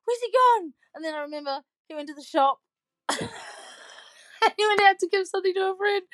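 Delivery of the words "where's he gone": high, loud freaked-out cry; frantic question; panicked. Retold or imitated. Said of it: "I yell and I'm freaking out".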